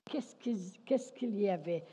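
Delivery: ordinary talk, nothing marked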